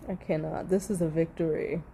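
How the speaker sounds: ordinary talk, nothing marked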